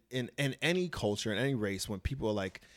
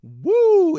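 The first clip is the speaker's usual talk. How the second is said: loud whoop; 15 dB louder than usual. Real, not acted. Bracing to tell a story.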